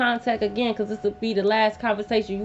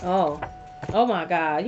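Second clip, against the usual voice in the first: ordinary delivery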